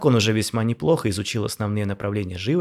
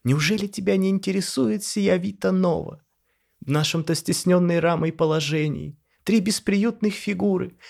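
much higher, melodramatic lament; theatrical dandy; 10 semitones higher, 4 dB louder than usual. A narrator's reading. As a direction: play it as a bored aristocrat.

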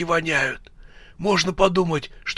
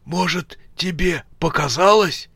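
higher, hesitant question; unsure; 7 semitones higher than usual. A narrator's reading. Rezak suggesting his partner imagined it, unsure and higher. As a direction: ask it tentatively, pitched up. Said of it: "неуверенно сказал Резак".

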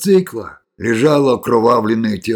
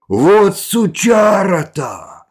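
high, loud, drawn-out shout; shouted curse; angry outcry; 8 semitones higher, 5 dB louder, much slower than usual. A narrator's reading. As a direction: cry out in outrage, stretching the words. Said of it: "вскрикнул он".

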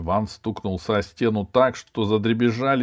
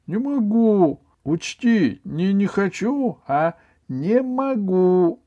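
put-on high, loud boy's refusal; high-voiced defiant pupil; stubborn schoolboy; 12 semitones higher, 5 dB louder, slower than usual. A narrator's reading. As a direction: insist in a raised, obstinate pitch. Ivan stubbornly refuses to study.

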